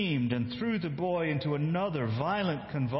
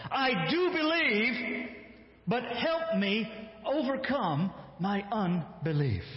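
higher, slow anguished plea; crying out; 7 semitones higher, slower than usual. Acted. Father crying out to Jesus, slow anguished plea. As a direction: cry it out desperately. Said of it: "instantly cried out".

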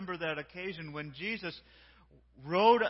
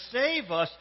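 raised, louder mock shout; mild retold shout, pitched up; imitated crowd shout; 6 semitones higher, 7 dB louder than usual. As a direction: call it out like the crowd, partly held back. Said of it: "they were shouting to him".

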